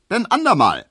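high, loud merry shout; cheerful call back. A narrator's reading. Hearse driver calls back merrily while driving off, high and loud. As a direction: fling it back cheerfully over the shoulder. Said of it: "rief der fröhliche Leichenkutscher zurück".

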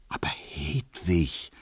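soft, slow, hushed pleading; urgent whisper. A narrator's reading. Barber begs the maid in secret, whispering urgently. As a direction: plead under your breath, drawing it out. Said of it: "flüsterte Emil Kubinke eindringlich".

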